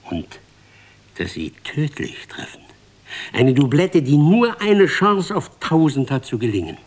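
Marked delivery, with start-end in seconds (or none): 3.3-6.8 emphatic swell. A narrator's reading.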